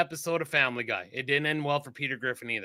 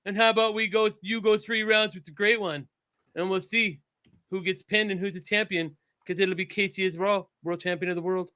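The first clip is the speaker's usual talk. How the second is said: higher put-on voice, laying out a challenge; Casey; 4 semitones higher than usual. Mocking Casey challenging a cop to wrestle, high put-on voice. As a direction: hold a raised, even character voice. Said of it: "And then he'd be like".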